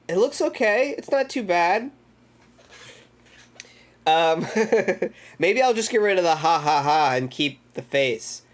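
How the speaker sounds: ordinary delivery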